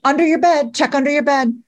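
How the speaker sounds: imitating a parent ordering kids about; mock shouted orders. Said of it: "And I would shout out".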